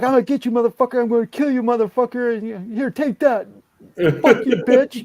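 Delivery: high, loud furious trash talk; raging gamer yell. Retold or imitated. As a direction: scream threats at the screen. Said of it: "So he's like".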